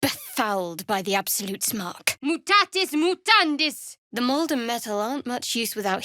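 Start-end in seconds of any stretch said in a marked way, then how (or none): 2.2-4.0 spell incantation. Acted. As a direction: call out the spell like a battle cry. Casting a spell in combat.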